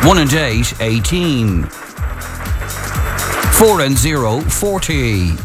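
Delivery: slow, drawn-out number calling; bingo caller. Acted. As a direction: call each number with a pause.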